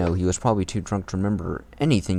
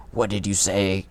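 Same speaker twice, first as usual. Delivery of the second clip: lightly voiced gruff rival redo; retry the line as a hint of Shadow. Shown as a demonstration. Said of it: "I didn't do the Shadow voice right".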